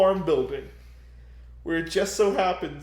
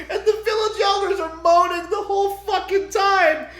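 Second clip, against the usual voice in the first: ordinary talk, nothing marked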